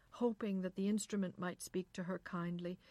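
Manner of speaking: usual speaking voice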